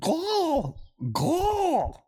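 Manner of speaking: high gobbling turkey call. Pretend. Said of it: "Turkey impression".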